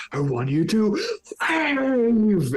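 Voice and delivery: high, drawn-out spectral screech; screeching ghost yell. Acted. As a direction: yell it out like a terrifying ghost, stretching the words. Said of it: "he just yells out".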